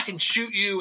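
high, loud imitated threat; mock furious shout; retold yelling. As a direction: yell it as an angry local warning off a trespasser. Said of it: "he's yelling at me".

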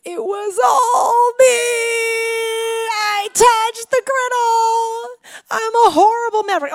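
high, wailing kid voice, mock sob; crying child confession. Son confessing to mom about the griddle, mock crying. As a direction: wail it out like a caught little boy. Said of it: "And he's like".

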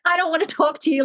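put-on high, sulky toddler voice; like a three-year-old. Mother retelling her own tantrum at Kiki, high sulky toddler voice. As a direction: pout like a small child in a tantrum. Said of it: "three-year-old".

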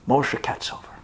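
plain reading voice

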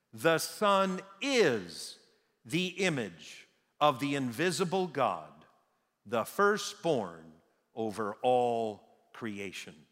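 each phrase drawn out slowly; slow emphatic quote. Shown as a demonstration. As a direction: let it land word by word, very slowly. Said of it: "says this".

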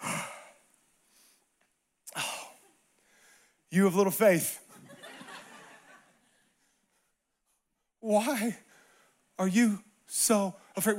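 lightly voiced Jesus. Jesus to the frightened disciples, gently reproachful. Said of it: "This is his first words".

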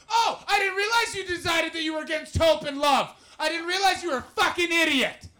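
furious shouting at a Tory voter; ranting shout. Retold or imitated. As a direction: scream it at the guy at the party. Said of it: "I went".

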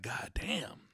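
breathless whisper; whispered damn. Retold or imitated. Paying out and getting nothing, whispered. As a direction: mutter it under your breath.

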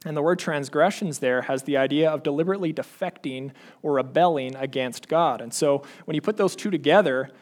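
usual speaking voice

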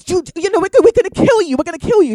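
loud, high mock threat; angry threat. Gunmen threaten the laughing hostages, loud retold anger. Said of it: "They get really angry".

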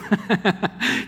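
high acted belly laugh, slowed down; hammed-up burst of laughter. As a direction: laugh out loud like old Sarah. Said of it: "She just laughs out loud".

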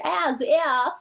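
high, mock Valley Girl sneer. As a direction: toss it off like a hammed-up Valley Girl. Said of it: "and then there's the Valley Girls".

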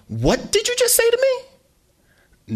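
slow, very high menacing yell; mother yelling at her son. Acted. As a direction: draw out each word as a threat. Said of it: "she yelled".

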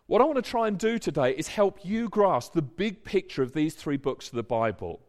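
ordinary talk

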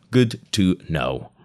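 slow, drawn-out deadpan; slow repeat. Real, not acted. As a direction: let each word hang, slowly. Repeats the aside slowly.

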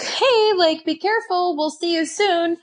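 a hint of fond parental caution, voiced up high; light mock of family saying be careful; family's send-off. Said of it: "my family was like".

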